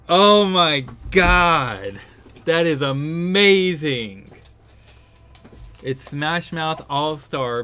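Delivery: loud, laughing disbelief at the joke; excited discovery. Real, not acted. Gamer realizing the song joke, loud delighted disbelief. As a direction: burst out with real delight. Realizes the lyrics are All-Star.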